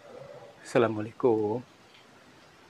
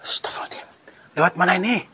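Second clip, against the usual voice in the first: ordinary delivery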